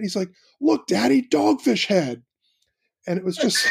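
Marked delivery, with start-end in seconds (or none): 0.6-2.2 put-on high, thrilled little-kid cry, slowing on "Dogfish Head"; excited toddler. Dad retelling his toddler shouting in the beer aisle, excited. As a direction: imitate a toddler proudly spotting the beer. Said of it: "Sam really loudly gets super excited".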